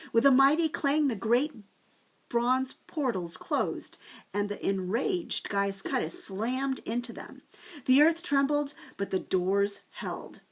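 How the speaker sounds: usual speaking voice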